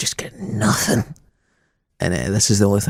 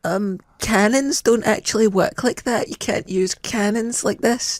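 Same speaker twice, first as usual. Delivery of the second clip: higher, slow mock nitpicker voice; pedantic viewer; 11 semitones higher, slower than usual. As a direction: whine it out like a fussy history pedant. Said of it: "people were like".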